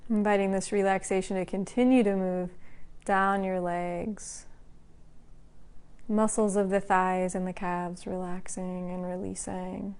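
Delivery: calm, even voice with long pauses between phrases; guided meditation. Acted. Teacher guiding the class to relax part by part, calm meditation voice. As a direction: lead a body scan, unhurried and level. Leads a body-scan meditation.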